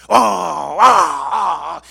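loud, drawn-out effort roar; big dog's straining roar. Acted. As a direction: roar as if heaving at a door. Big dog lunging at the door. (rugido de esfuerzo del perrote)

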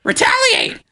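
squawking crow cry, very high. Retold or imitated. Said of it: "That's my crow voice".

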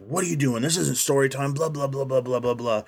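a hint of nagging, running into blah-blahs; mock rude roommate. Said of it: "interrupted by his rude, what seems like a hypochondriac roommate".

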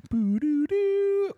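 high, drawn-out computer start-up noises; sign-in jingle noise. Retold or imitated. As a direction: imitate an old dial-up sign-in sound. Imitates AOL sign-in sound.

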